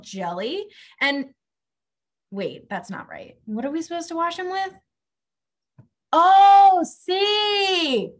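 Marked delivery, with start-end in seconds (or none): 6.1-8.1 surprised. Acted. Spots the shampoo.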